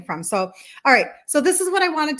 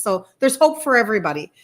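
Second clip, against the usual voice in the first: normal talking voice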